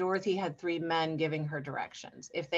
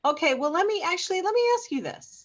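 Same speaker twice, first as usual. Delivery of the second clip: excited; 10 semitones higher, 4 dB louder than usual. Real, not acted. Excited leading into question.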